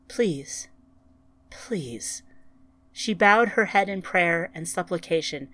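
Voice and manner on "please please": pleading, dropping low and soft; fading plea. A narrator's reading. Ryan prays to the planet, plea fading low. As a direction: let the plea sink away.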